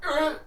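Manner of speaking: sharp high startled grunt; a thug caught off guard, grunting in shock. Acted. Thug startled by a sudden teleport, sharp high grunt.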